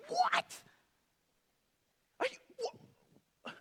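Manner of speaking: squeaky, very high stunned what; shocked disbelief. Retold or imitated. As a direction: voice cracking up in disbelief, fading to a breath. Brother's shocked reaction.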